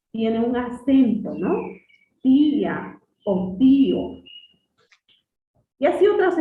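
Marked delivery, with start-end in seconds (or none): 2.2-4.2 Tía o tío slow and loud, hitting the I; stress on the I. Shown as a demonstration. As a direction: stretch out Tía o tío, leaning on the I. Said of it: "si recae la mayor fuerza de voz". ("Tía o tío" lento y fuerte, marcando la I)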